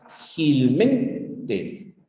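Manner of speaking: "Felizmente" very slow, with both "liz" and "men" stressed; slow syllable-by-syllable "Felizmente"; two-stress demo word. Shown as a demonstration. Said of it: "Y dice acá".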